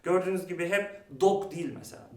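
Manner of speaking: ordinary talk, nothing marked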